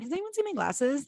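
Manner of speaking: high, polite imitated question; nice and gentle. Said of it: "So nice and gentle".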